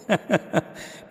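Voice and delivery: short unvoiced chuckle; a quick amused laugh through the nose; breathy laugh. Real, not acted. Preacher laughs at the photocopy trick, a short chuckle.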